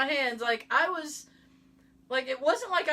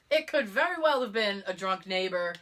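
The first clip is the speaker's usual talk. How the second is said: normal talking voice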